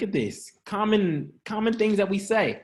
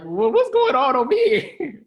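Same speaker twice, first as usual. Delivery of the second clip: mock surprised partner; 8 semitones higher, 4 dB louder than usual. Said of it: "Significant other looking like".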